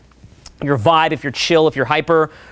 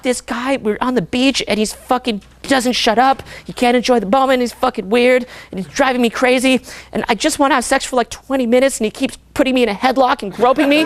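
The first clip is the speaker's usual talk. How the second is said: lightly voiced fed-up girlfriend, higher; exasperated girl; 5 semitones higher than usual. Imitating a girl driven crazy by him, mildly exasperated. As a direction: complain in a higher, exasperated girl's voice.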